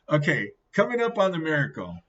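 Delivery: ordinary delivery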